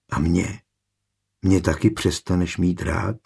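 soft, tender and hushed; tender question. A narrator's reading. Kačka asking if he will stop loving her, tenderly. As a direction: ask gently, keeping it small. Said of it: "něžně se zeptala".

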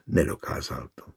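ordinary delivery

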